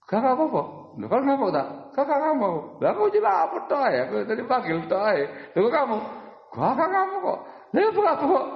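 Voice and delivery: high, bright mock denial back and forth; imitating someone. Teacher acts out someone denying anger, in a high mock voice. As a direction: play a flustered person insisting all is fine, pitched high. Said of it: "kayaknya begitu gitu loh".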